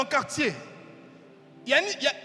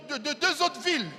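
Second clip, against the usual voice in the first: usual speaking voice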